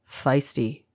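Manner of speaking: lightly playful murmur; understated, teasing captor's voice; playful whisper. A narrator's reading. Captor teases the struggling angel, lightly playful whisper. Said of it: "whispered the voice playfully".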